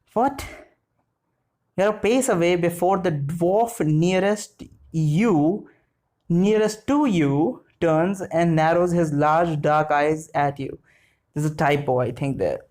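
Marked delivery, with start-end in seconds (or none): 0.1-0.4 short, higher rising question; puzzled at what was just read; baffled. Real, not acted. Baffled by the text being read. 1.8-10.8 slow, deliberate reading; careful rereading. Real, not acted. Rereads the confusing sentence carefully.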